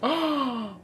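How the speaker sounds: pained cry, then a gasp; cringing gasp. Real, not acted. Reader overcome by the cringe, crying out and gasping. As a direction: recoil from your own impression. Recoils after the impression.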